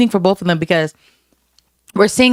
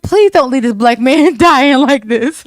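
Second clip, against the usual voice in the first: laughing plea; 5 semitones higher, 3 dB louder, slower than usual. Retold or imitated. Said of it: "I was like".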